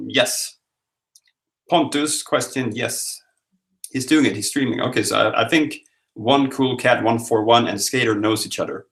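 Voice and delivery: usual speaking voice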